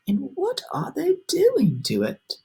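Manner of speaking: small timid bug, hushed wonder; ladybug voice. A narrator's reading. Ladybug asking friends about cloud men, high hushed voice. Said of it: "whispered the ladybug".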